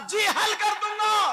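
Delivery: loud, proclaiming preaching; fervent sermon. Acted. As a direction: proclaim it to the crowd. Preacher proclaims boy's answer. (بلند آواز میں اعلان کرتی خطابت)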